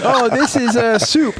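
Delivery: high, slow, indignant insistence; guard insisting it's soup, high and slow; mock indignant. Guard insisting hot water is soup.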